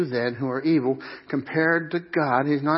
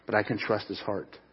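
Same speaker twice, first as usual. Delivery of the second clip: normal talking voice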